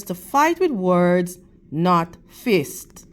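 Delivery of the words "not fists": ordinary delivery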